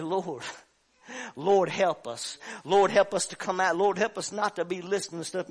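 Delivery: loud pleading prayer; fervent prayer. Acted. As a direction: cry out to the Lord for help. Leads a prayer.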